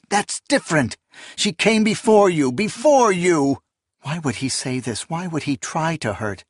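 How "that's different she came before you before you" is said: raised, high-pitched, cutting in; indignant outburst climbing in pitch and force; heated protest. Acted. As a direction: protest hotly, drawing out the last repeat. Acted quarrel over her daughter.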